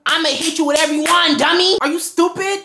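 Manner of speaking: loud, high, combative outburst; hammed-up scolding shout; mock argument blow-up. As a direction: let them have it in a put-on tirade.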